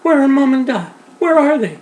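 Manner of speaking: very high, loud tearful wail; lost girl wailing for mum and dad, high and loud; sobbing child. Acted. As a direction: cry out for her parents. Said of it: "Alice sobbed".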